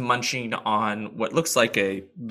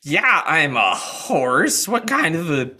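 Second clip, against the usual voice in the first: a horse baffled by a dumb question; indignant horse; 7 semitones higher, 7 dB louder than usual. Acted. GM voices Jerry the horse.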